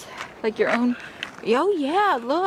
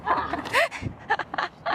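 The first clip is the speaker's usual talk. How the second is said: laughing; 7 semitones higher than usual. Real, not acted. Laughing at a swinging child.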